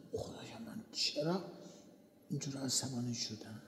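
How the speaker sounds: hushed, regretful whisper to God; softly whispered prayer of regret; remorseful whisper. Retold or imitated. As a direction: murmur it as a quiet confession. Remorseful self-talk to God.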